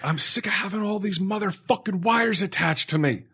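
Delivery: high-pitched mock screaming rant; retold scream. Patient screaming at partner over the wires, high mock scream. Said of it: "and I screamed".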